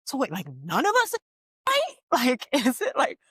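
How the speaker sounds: high, incredulous squeal of disbelief; animated retelling of Taraji's outrage. Retold or imitated. Friend retelling an actress's indignant joke, squealing. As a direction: pitch climbing way up in mock outrage. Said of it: "Taraji said".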